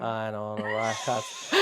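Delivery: ordinary talk, nothing marked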